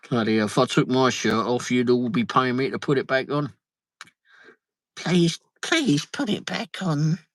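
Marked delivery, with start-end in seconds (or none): 5.0-7.2 mock plea. Mock plea to keep shirt on.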